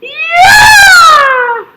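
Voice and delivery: screaming high and loud as the tying goal goes in; ecstatic goal yell. Real, not acted. Fan roaring at the screen for the tying goal, ecstatic yell. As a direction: let it rip, full-throated goal call.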